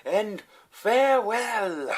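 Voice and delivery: very high, loud, slow flourish; imitated grand farewell. Diner shoved into police car, hammed-up grand farewell. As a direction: sing out a theatrical goodbye, stretching it. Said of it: "he goes".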